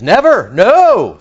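slow, loud, high refusal; a stubborn no, dragged out and loud; drawn-out loud refusal. Retold or imitated. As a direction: stretch out a loud "Never, no". Peter's flat refusal.

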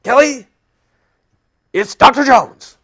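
imitating Doctor Jones. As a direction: announce yourself like the stiff boss on the phone. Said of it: "that's how he talked".

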